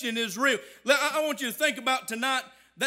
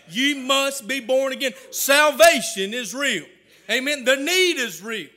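loud, slow, punched declarations; full-force preaching; 7 dB louder than usual. Real, not acted. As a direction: hammer home each short phrase loudly.

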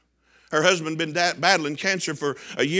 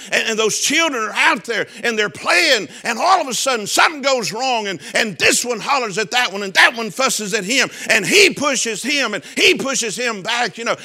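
loud rising preacher's cadence, near singing; whooping sermon chant; preaching at full force; 5 semitones higher, 4 dB louder than usual. Real, not acted. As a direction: build the story up into a chanted crescendo.

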